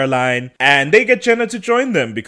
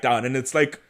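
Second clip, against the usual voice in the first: ordinary talk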